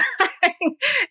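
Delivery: real high laugh at herself. Real, not acted. Laughing at her own anxious pleading, high laugh. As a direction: let a high laugh escape.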